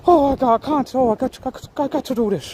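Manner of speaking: high, put-on Scottish voice, flustered; mock Scottish accent. Man declining an interview, in a mock Scottish accent. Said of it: "his nice little Scottish accent".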